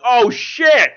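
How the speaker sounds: startled high loud blurt; shocked exclamation. Retold or imitated. Hearing James Wan speak, a startled loud outburst. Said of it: "And I'm like".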